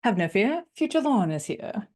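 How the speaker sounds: put-on high, swooping English accent; lilting mock-English entrance. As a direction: announce yourself like a rescuer in a put-on English accent. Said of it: "why do I have an accent?".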